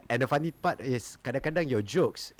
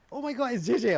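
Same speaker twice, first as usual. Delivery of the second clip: excited fan; 12 semitones higher, faster than usual. Retold or imitated. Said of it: "Keng Hua is like".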